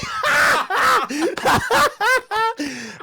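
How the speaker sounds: shrieking laugh, high and loud. Real, not acted. Boxer cracking up while retelling the rival's insult, shrieking laugh. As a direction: burst out laughing at the memory.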